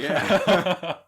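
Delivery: laughing through the word. Real, not acted. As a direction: breaks into laughter. Laughing at the short bus joke.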